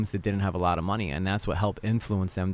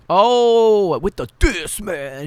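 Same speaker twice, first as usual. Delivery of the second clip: loud, high crowd-style "ohh"; hyped-up reaction; 10 semitones higher, 10 dB louder, much slower than usual. Real, not acted. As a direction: whoop at a savage put-down. Hyping up an insult in debate.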